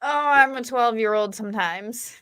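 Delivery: ordinary talk, nothing marked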